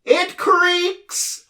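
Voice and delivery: very high loud bellow. A narrator's reading. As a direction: shriek it out, near laughter. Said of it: "bellowed the din".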